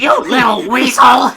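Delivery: high, loud furious yell. A narrator's reading. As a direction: scream it at him. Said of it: "Eddie yelled".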